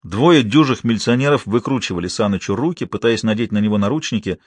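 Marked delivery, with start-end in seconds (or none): none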